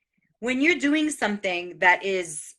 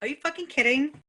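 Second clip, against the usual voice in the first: fast, higher mock disbelief; retold disbelief, quick and high; incredulous; 6 semitones higher, faster than usual. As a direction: scoff at the idea. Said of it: "And I was like".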